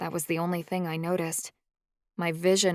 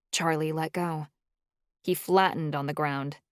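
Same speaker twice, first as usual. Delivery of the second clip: usual speaking voice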